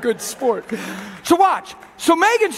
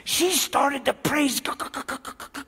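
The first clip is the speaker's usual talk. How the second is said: cowering imp; 5 dB quieter than usual. Acted. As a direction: shrink like a scared little imp reporting failure. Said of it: "this little imp goes".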